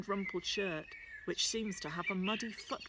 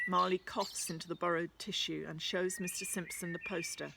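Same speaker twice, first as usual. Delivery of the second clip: plain reading voice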